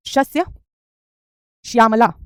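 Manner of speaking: शस्य, long pause, then श्यामला; careful pronunciation. Shown as a demonstration. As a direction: split शस्य and श्यामला apart, slowly, for dictation. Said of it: "तो आप कहेंगे".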